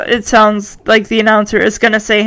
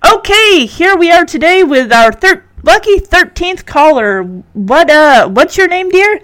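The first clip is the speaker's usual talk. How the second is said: higher, peppy contest-host voice; radio announcer; 6 semitones higher than usual. Acted. Radio announcer greets the winning caller, hammily upbeat. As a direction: put on an upbeat DJ welcome. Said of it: "The announcer comes back on the radio and says".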